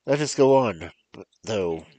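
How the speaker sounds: Fortunato, eager. Acted.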